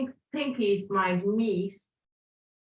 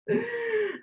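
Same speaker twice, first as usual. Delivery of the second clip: amused laugh; 12 semitones higher than usual. Real, not acted. After joking about enjoyable plank.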